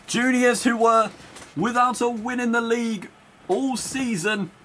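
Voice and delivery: high, drawn-out excited proclamation, gradually easing; thrilled commentary. Real, not acted. Commentator on Juniors getting back into the game, thrilled. Commentator celebrating a comeback goal.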